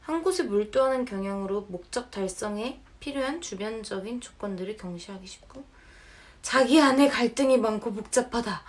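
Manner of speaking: ordinary talk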